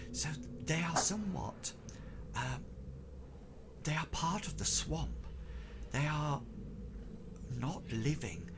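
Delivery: hushed, halting storyteller's whisper; Tobias. Acted. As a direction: murmur it softly and secretively, with long pauses. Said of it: "his speech that he was making".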